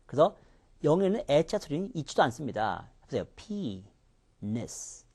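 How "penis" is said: "penis" slow and split, long falling PEE, then soft -nis; stress on the first syllable. Shown as a demonstration. Teacher breaks the word down for students, slow front-stressed "penis". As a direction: sound out "penis" softly, front syllable stretched. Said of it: "앞에가 셉니다".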